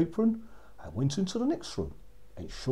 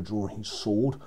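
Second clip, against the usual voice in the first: ordinary delivery, nothing marked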